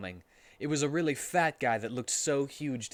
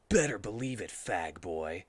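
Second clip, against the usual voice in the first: Shadow voice; 4 semitones lower than usual. Acted. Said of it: "a voice that sounded just like Shadow's".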